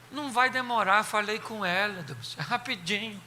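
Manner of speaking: calm him down gently; soothing. Retold or imitated. Aunt soothes uncle.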